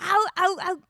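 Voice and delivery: loud high ow ow; pained ow. Real, not acted. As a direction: wince out loud. Reacting to embarrassing story.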